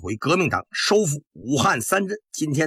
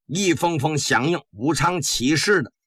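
ordinary delivery

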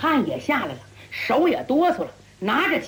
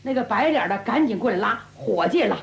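ordinary delivery

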